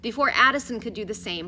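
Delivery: usual speaking voice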